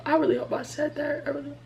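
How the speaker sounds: breathy whispered aside. Real, not acted. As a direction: whisper it to yourself. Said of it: "Don't know why I was stuttering".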